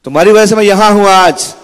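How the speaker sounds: high, loud accusing cry on वजह; bitter accusation. Acted. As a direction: blame the mother loudly. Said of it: "repeated louder". (ऊँची, ज़ोरदार इल्ज़ाम भरी पुकार)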